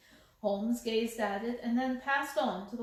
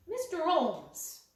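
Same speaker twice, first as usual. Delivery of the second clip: indignant surprise; 6 semitones higher, slower than usual. Acted. Lady shocked at demand.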